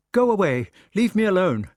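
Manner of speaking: high, loud, furious push away; angry outburst. A narrator's reading. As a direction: shout him off in a rage. Queen fighting off lover.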